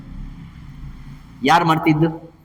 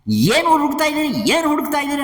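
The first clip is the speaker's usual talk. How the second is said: higher-pitched questioning wife; a hint of a wife, pitched up; lightly voiced wife; 7 semitones higher, faster than usual. Wife asking her searching husband, lightly acted questioning. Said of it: "ಅಂತಂದು ಹೆಂಡತಿ ಕೇಳ್ತಾಳೆ".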